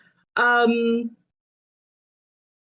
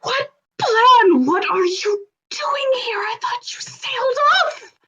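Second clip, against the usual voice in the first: shocked whisper; 14 semitones higher, 3 dB louder than usual. Acted. Shocked to see her mother.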